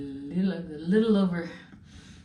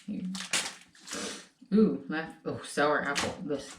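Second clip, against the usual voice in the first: normal talking voice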